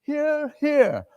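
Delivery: high, meek hear hear; timid clerk. Acted. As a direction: chime in from the corner. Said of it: "quietly seconded".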